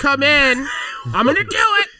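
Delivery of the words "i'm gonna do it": high, laughing hammed-up threat; laughing mock threat. Friend plays the bomber threatening, high and laughing. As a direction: play a giggling madman.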